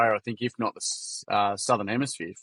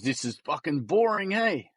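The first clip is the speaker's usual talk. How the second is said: higher-pitched Kiwi boy impression; Kiwi accent; 5 semitones higher than usual. Kiwi boy complains to sir about the session, high Kiwi accent. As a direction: imitate a Kiwi lad, lifting at the end. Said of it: "Excuse my Kiwi accent. I'm gonna do my best".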